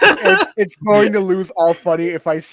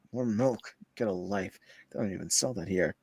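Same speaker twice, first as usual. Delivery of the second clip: snooty guy voice; 8 semitones lower, 11 dB quieter than usual. Acted. Voices rude guy in post.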